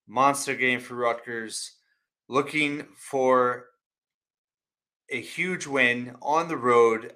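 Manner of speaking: usual speaking voice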